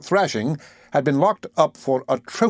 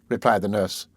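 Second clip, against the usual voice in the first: ordinary delivery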